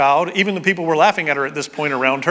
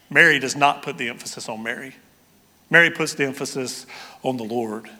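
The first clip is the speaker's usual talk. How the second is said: normal talking voice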